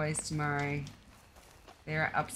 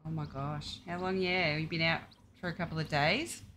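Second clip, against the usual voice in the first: usual speaking voice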